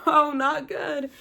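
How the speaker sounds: slow high mock-glum reply; laughing honest answer. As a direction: drag out the bad news with a laugh. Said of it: "I'm like".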